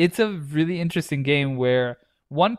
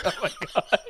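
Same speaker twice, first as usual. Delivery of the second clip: laughing; 4 semitones higher, 4 dB quieter, faster than usual. Real, not acted. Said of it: "the wheezing laugh".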